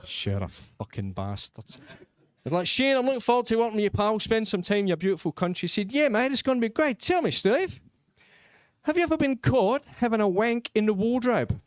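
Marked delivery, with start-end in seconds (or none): 2.7-5.6 put-on quick, higher Australian accent; keen Australian accent, lightly done. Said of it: "That Australian accent". 5.9-11.6 put-on higher, cheery Australian accent; matey Australian accent with a deadpan question. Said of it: "That Australian accent".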